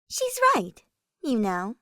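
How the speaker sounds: a playful spirit speaking in his head; Sothis. Acted. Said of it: "came Sothis' voice in his mind".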